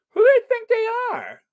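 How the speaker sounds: high, fast put-on outrage; mock indignant squeal. Squealing mock outrage at the gala organisers.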